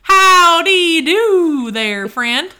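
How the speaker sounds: slow drawled Southern howdy; Southern accent. Acted. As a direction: greet like a folksy cowboy. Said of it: "that's where my little howdy came from".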